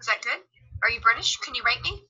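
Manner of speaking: ordinary talk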